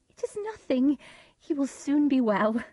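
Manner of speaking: calm the guests with a smile; Lady Macbeth. A narrator's reading. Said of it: "Lady Macbeth said to the guests".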